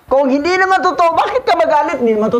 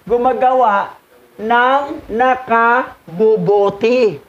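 slow emphasis; 2 semitones lower, slower than usual. Shown as a demonstration. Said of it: "repeats the reading slowly to stress it".

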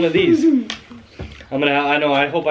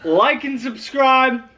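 outro sign-off; 8 semitones higher, much slower than usual. Acted. Channel outro.

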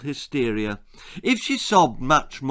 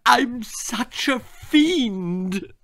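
high, very slow wail of self-pity; a parent overplaying guilt, high and drawn out; hammed-up despairing parent; 9 semitones higher, much slower than usual. A narrator's reading. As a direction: moan it like a melodramatic parent.

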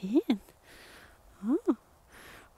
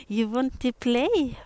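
louder and higher, eager; excited dog talk; 6 semitones higher, 8 dB louder than usual. Real, not acted. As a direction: hype the dog up to play.

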